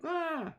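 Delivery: very high, short mock scream; imitated scream. Streamer retelling someone screaming near a dog, high mock scream. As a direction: squeal out a mock scream, not full volume. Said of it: "screaming at the top of their lungs".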